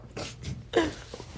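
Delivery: soft airy giggle under the breath; real little laugh; breathy laugh. Real, not acted. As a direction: let out a quiet breathy laugh. Laughs at herself.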